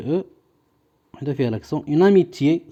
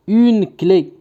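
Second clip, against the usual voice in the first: higher, measured dictation; 4 semitones higher, 4 dB louder than usual. Shown as a demonstration. Teacher giving pupils a word to write, dictation voice. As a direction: dictate it clearly to the class.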